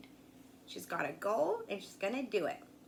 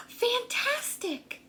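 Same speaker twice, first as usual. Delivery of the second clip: high, slow delighted cheer; high excited cheer; 10 semitones higher, 3 dB louder, slower than usual. A narrator's reading. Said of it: "but Elizabeth shouted".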